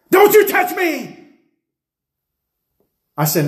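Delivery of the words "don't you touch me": high, loud possessed shout; demonic shout. Retold or imitated. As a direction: bark it at him like a demon. Possessed girl shouting at preacher.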